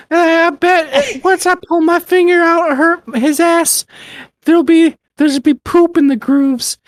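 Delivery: put-on high old lady reading; Doris old lady voice. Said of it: "read it in her old lady voice".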